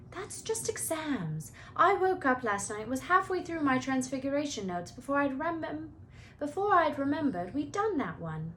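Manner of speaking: a hint of know-it-all girl; Hermione voice.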